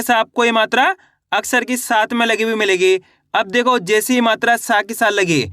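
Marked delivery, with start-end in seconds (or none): none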